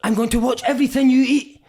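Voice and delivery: put-on high, stern Italian accent; Paolo Di Canio. Italian manager threatening his player's diet, Italian accent. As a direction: warn him like a strict Italian boss. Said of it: "So he's like".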